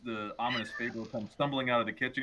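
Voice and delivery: ordinary talk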